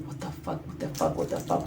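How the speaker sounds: breathy whispered curses; frantic whisper. Retold or imitated. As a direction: curse under your breath in panic. Cramped room, panicking inside.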